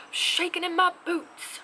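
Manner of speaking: high, loud, slowed terror; terrified. Shown as a demonstration. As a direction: ham up being terrified out loud. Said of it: "On the scale of".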